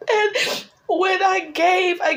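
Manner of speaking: high tearful voice, slowed; fighting back tears to go on; crying. Real, not acted. Widow telling viewers, still crying.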